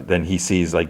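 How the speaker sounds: ordinary delivery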